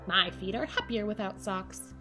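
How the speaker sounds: ordinary delivery, nothing marked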